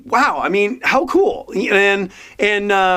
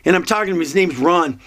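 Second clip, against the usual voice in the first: ordinary talk, nothing marked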